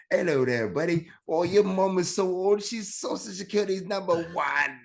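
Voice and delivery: put-on British accent. Shown as a demonstration. Comic retelling a yo-mama joke with an accent, high mock British accent. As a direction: sing out the insult in a cheery British accent. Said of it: "you say that shit with an accent".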